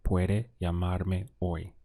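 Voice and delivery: "Puede llamarme hoy" slow and level, no rise on "hoy"; slow, declarative "Puede llamarme hoy"; statement intonation. Shown as a demonstration. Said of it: "same for a statement or a question".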